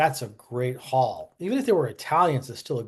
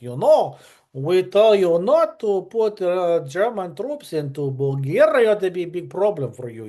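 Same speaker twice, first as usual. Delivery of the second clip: hammed-up Russian accent; 5 semitones higher, 5 dB louder than usual. Soviet minister warning Germany off Bulgaria, in a hammed-up Russian accent. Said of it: "I should do a better job than that".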